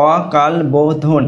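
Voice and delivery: অকাল বোধন drawn out slowly, syllable by syllable; slow syllable split. Shown as a demonstration. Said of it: "অকাল বোধনের দল বিশ্লেষণ করে দেখালাম".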